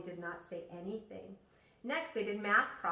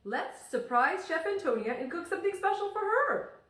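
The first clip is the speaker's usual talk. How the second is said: higher, louder friendly teacher; cheerful teacher voice; 8 semitones higher, 7 dB louder than usual. Acted. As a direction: sound like a beaming teacher inviting the class. Teacher voiced in picture book.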